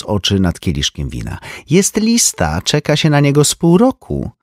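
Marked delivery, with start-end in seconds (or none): 1.7-4.3 lightly voiced knowing socialite; a hint of a snooty sister; Ursula's voice. A narrator's reading. (lekko wyniosła siostra)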